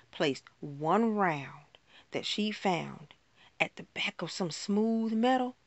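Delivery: usual speaking voice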